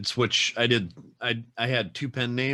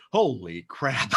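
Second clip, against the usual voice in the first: slow stunned surprise; slower than usual. Retold or imitated. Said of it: "I looked at him like".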